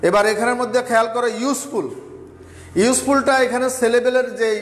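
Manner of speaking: ordinary talk, nothing marked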